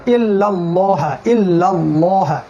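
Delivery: ইল্লাল্লাহ slow, with a heavy, thick ল. Shown as a demonstration. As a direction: draw out ইল্লাল্লাহ with a full, dark ল. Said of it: "ল পড়লাম".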